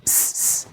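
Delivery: "س-، س-،" as a quiet voiceless hiss; whisper-hiss "س-، س-،" with no vowel; bare s hiss. Shown as a demonstration. Teacher drilling the s sound "س-، س-،". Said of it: "يبقى الإس صوته".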